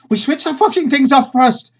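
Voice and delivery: high, loud, exasperated yell; angry shout. Acted. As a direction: bark the protest at him. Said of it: "and she goes".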